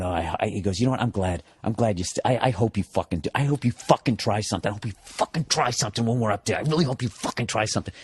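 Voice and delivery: put-on low, soft menacing mutter of a drunk passenger; quiet menacing threat. Drunk passenger threatening the comedian in his ear, low menace.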